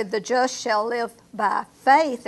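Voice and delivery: slow, with "faith" pressed loud; stress on faith. Shown as a demonstration. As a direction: end with a strong push on "faith". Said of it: "and you emphasize faith".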